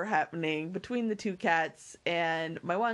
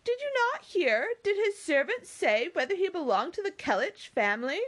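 high, eager questioning; excitable Mary; 11 semitones higher than usual. Acted. Mary questioning the waiter about the servant, excited.

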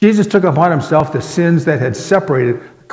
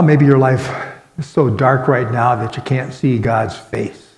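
usual speaking voice

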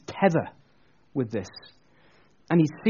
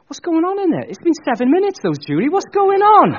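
high, loud exasperated yelling; impatient outburst; 13 semitones higher, 9 dB louder than usual. Acted. Speaker to Julie after seven minutes' wait, exasperated shout. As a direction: shout it up high, fed up with waiting.

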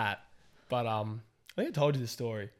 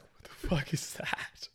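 slow, hushed disbelief; stunned whisper; 4 semitones higher, slower than usual. Retold or imitated. Diner hearing distant screaming, stunned whisper. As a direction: whisper it slowly, aghast. Own stunned reaction, retold.

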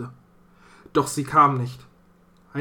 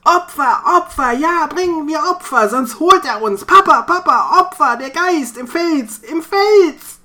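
much higher, much louder frantic raving; crazed raving; 14 semitones higher, 9 dB louder than usual. Acted. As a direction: rave like a man gone mad with fear. Theo raves wildly in the storm. (Theo schreit wahnsinnig vor Angst von Opfern)

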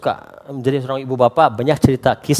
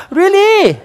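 squeaky high, loud disbelief; incredulous; 7 dB louder than usual. Real, not acted. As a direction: ask it in amused disbelief.

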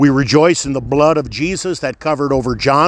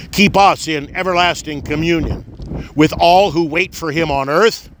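ordinary delivery

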